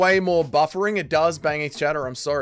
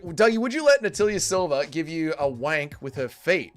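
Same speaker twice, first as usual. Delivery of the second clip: ordinary talk, nothing marked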